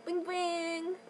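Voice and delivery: put-on slow, high cutesy baby voice; aegyo. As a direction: coo it like a cute idol. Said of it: "that's my aegyo".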